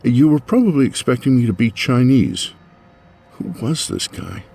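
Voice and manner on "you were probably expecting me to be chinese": blathering. Acted. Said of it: "I blathered".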